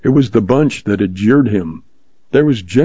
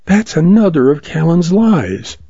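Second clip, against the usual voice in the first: put-on young woman voice; 7 semitones higher than usual. Lois denies Callan's lie.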